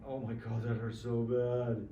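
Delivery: low, drawn-out mock whimper; mock crying. Teacher voicing his hidden pain, mock crying. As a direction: imitate a little kid crying in pain, slowly. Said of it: "I'm crying like a little kid".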